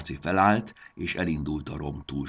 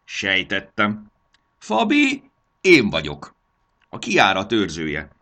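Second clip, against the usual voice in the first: loud, animated gnome with high leaps; self-important gnome; 4 semitones higher, 8 dB louder than usual. Acted. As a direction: boast grandly to the visitors. Boastful gnome introduces himself. (fontoskodó, hangos, csapongó manóhang)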